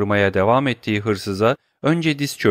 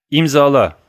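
curt command; 4 semitones higher, 7 dB louder than usual. Acted. Count orders the signature.

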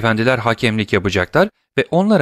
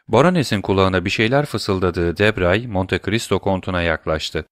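usual speaking voice

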